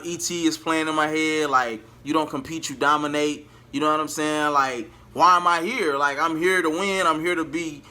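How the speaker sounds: normal talking voice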